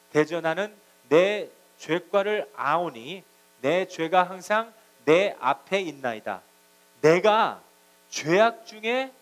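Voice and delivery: slow, emphatic psalm reading; solemn scripture recitation. Acted. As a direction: read it out loud in measured, drawn-out phrases. Reads the psalm aloud.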